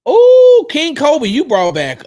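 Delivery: loud, high drawn-out "oh", then easing down; excited shout-out. Real, not acted. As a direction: hail them with a big excited whoop. Excited greeting of a viewer.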